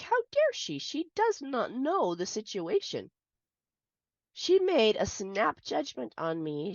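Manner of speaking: retold outrage, pitched high and loud at the start, then settling; indignant disbelief, replaying how furious she felt; livid. Angry mother fuming at the neighbor who yelled at her. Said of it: "I was livid".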